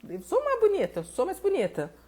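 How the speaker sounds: high, outraged protest; mock indignation, voice jumping high; indignant inner voice. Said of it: "Você fala".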